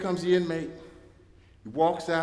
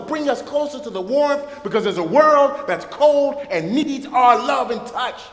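slow, raised preaching voice, higher and louder; 6 semitones higher, 6 dB louder than usual. Acted. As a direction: preach it slowly with full force. Preaching close of sermon.

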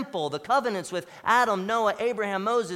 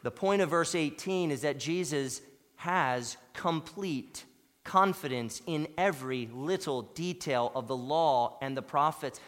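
usual speaking voice

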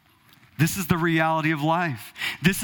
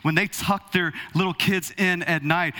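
ordinary talk

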